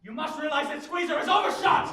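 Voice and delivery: high, loud, exasperated insistence; angry shout. Acted. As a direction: shout the point at a stubborn opponent. Same claim, now in a heated quarrel.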